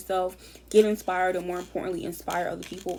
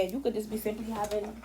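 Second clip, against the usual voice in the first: ordinary delivery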